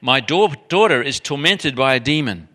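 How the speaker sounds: imitated loud, pleading cry; retold shout. Mother shouting to Jesus for her tormented daughter, acted shout. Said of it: "she starts to shout at him".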